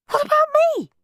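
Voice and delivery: very high, loud squeal of protest; outraged child. A narrator's reading. Henry left out of the dinner, outraged. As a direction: squeal it in indignation.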